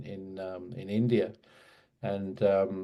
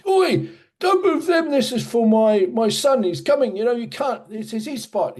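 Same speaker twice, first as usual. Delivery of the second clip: put-on much higher, louder shouting old Englishman; imitated angry yell; 12 semitones higher, 6 dB louder than usual. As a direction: screech it at the boys like an outraged neighbour. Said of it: "screaming at them and saying".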